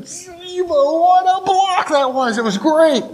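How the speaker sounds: gleeful inner cheer, stretched out and pitched high; elated inner voice. Acted. Player secretly relishing his coach's praise, elated high squeal. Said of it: "in the back of my head".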